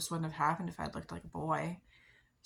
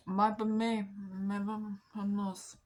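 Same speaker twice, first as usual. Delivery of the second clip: silly put-on voice, slow and drawn out; playful weird voice, raised a little; 3 semitones higher, much slower than usual. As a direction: stretch it out in an odd, goofy voice. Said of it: "I did the weird voice".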